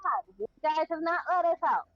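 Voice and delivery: normal talking voice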